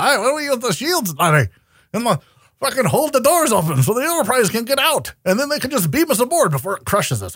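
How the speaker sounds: a lightly voiced Scottish accent sliding toward a pirate; mock Scotty in a shaky Scottish-pirate brogue; half-hearted pirate-Scottish accent as Scotty; Scottish turned pirate accent. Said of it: "the pirate accent".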